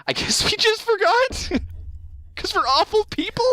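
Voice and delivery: high, squeaky voice breaking into laughter; laughing confession. Real, not acted. Host admitting they forgot the guest, high laughing voice.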